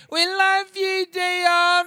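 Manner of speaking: high screaming fan chant; high adoring shriek. Retold or imitated. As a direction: scream it up at the stage like excited girls. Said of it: "like, went".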